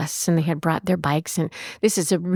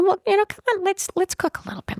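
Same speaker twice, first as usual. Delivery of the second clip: much higher, lightly coaxing retold invitation; 13 semitones higher, faster than usual. Host to a withdrawn teenager about cooking, lightly coaxing. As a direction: coax her along in a high voice.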